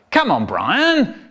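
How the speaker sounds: a touch of a cross dad, drawn out; mildly impatient dad, swooping pitch; a bit cross. Acted. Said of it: "getting a bit cross".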